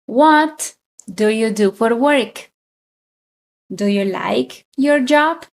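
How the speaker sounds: ordinary talk, nothing marked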